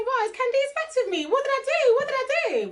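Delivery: high, anxious worried voice; worried inner voice. Retold or imitated. Recalling her worry about Candice, in a high anxious voice. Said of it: "I was thinking".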